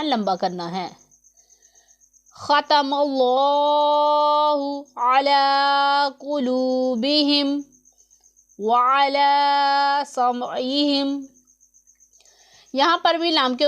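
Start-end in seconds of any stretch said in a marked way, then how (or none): 2.4-11.3 very slow, stretched Quranic chant; tajweed recitation. Shown as a demonstration. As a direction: draw out each vowel in recitation. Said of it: "लाम को पुर पढ़ेंगे".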